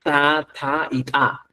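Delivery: "ta, ta y ta" said slowly and set apart, each one different; three kinds of ta. Shown as a demonstration. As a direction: show the three variants slowly. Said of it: "Tres maneras de producir este sonido". ("ta, ta y ta" despacio y separadas)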